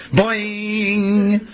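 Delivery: long, high ringing boing; springing "boing". Retold or imitated. The branch springs back up, high drawn-out boing. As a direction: imitate a spring in a high sustained note.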